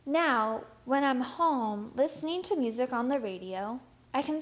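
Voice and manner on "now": plain reading voice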